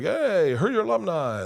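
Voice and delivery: high, bright fake-friendly hello; mock cheery school caller. School calling for money, in a mock cheery voice. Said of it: "they're like".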